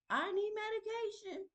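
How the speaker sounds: very high-pitched comic plea; mock desperation. Wife worn out by husband's yelling, mock-desperate squeal.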